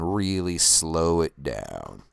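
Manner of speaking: very slow, sinking in pitch; words drag like footage slowing down; slow-motion drawl. Shown as a demonstration. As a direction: stretch each word out, winding down. Voice mirrors slow-motion effect.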